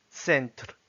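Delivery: "Centre" as Russian "tsentr"; Russian pronunciation. Shown as a demonstration. Teacher reading words with learners, "Centre" in Russian. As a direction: read "Centre" in Russian, starting "ts". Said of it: "s'entraîner à lire les mots".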